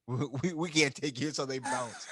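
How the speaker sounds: breaking into laughter while quoting; laughing. Real, not acted. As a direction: giggle out the words mid-story. Retelling a betrayal scene, laughing.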